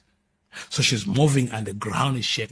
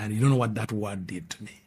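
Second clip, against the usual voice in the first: usual speaking voice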